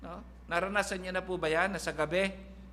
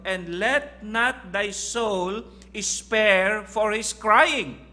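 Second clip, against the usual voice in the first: very slow, louder rising proclamation of the verse; preaching scripture; 4 semitones higher, 7 dB louder, much slower than usual. Acted. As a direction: proclaim the verse from the pulpit, drawn out. Reads Bible verse with force.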